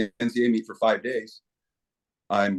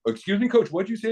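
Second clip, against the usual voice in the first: higher-pitched mock query; feigned innocent question; 10 semitones higher than usual. Official playing dumb with a coach, feigned innocence. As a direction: ask as if you never heard it.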